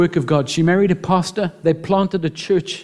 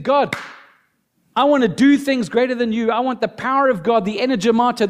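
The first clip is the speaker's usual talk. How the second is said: fervent prayer; 6 semitones higher than usual. Pretend. Voicing a model prayer.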